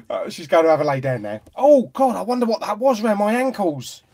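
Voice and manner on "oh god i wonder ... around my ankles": loud, high-pitched mock alarm; mock startled. Host reacting to cat round the ankles, mock startled. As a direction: exclaim in playful fright, pitched up.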